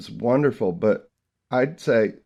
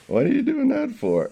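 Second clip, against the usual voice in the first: put-on puzzled baby; 14 semitones higher than usual. Voicing the baby's puzzled look.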